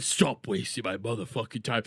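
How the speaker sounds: mock tough guy. Streamer imitating the creator's tough line, low and mocking. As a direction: quote it as a fake tough bro. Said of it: "When he said".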